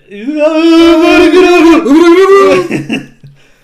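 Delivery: big sing-song laugh; loud laughing. Real, not acted. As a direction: burst out laughing loudly. Laughs at the scene.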